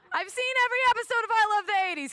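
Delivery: high, loud, rushed pleading; eager pleading. Retold or imitated. Pleading with the older guy, fast and high. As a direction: blurt it out desperately.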